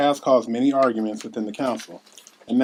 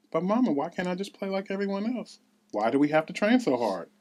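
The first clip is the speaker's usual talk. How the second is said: put-on child's voice; 7 semitones higher than usual. Young Ella asks her mother.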